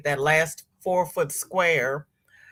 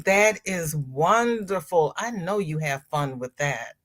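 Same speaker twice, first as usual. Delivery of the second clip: ordinary talk, nothing marked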